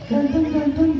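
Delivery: high puppet voice, cheeky; monkey puppet voice. Acted. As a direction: answer like a cheeky little monkey.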